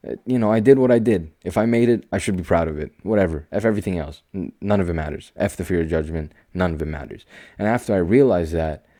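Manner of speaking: usual speaking voice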